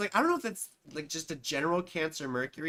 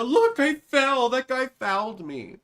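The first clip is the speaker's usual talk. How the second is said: whiny faker; 7 semitones higher, 7 dB louder than usual. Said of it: "You know".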